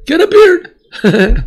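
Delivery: high, drawn-out joking voice; mock shopkeeper. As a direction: stretch it out like a silly salesman's pitch. Joke about a costume store.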